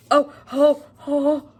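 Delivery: high, loud, fast squeals; excited squeals. Real, not acted. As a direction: squeal while unwrapping. Unboxing illustrated edition.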